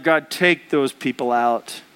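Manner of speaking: low, slow prayer to take them out; praying for enemies' destruction, low and slow; vengeful prayer. Retold or imitated. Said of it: "prayers like".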